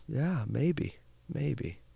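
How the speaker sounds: very soft, noncommittal reply; understated farmer. Acted. Farmer answers excited neighbors, softly noncommittal. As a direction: murmur it quietly, unmoved by the fuss.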